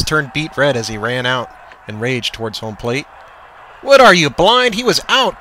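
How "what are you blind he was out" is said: high, loud outraged yell; furious exclamation. A narrator's reading. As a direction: blast the umpire in disbelief. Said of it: "Coach exclaimed".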